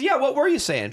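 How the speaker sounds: high, pointed challenge; mock challenging cop. Trooper confronts the heckler, in a high mock challenge. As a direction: throw the question back at him.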